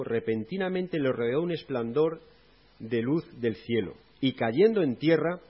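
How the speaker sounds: loud, slow, proclaiming Bible reading; scripture reading. Acted. Preacher reads Acts nine to the congregation, loud and slow. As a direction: read the verse out like a proclamation.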